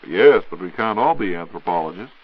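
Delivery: ordinary talk, nothing marked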